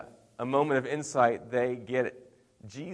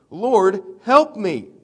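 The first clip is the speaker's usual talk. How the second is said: high, loud impassioned plea; begging; 7 semitones higher, 10 dB louder, much slower than usual. Retold or imitated. Kneeling woman begs Jesus for help, retold begging cry. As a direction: cry out, pitch and volume leaping. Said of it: "and begs".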